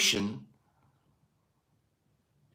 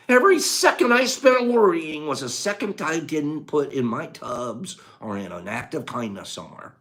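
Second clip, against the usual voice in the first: ordinary delivery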